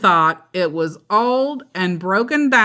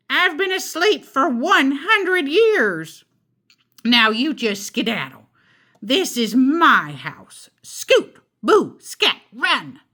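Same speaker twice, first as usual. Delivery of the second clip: bossy witch; 6 semitones higher than usual. Acted. Witch orders Nicky and his mother to scoot, high and shrill. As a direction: chase them out like a cranky old witch. Witch shoos them away.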